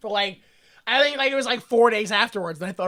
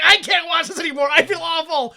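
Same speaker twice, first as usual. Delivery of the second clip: mock anguished shout; 7 semitones higher, 4 dB louder than usual. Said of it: "I was like".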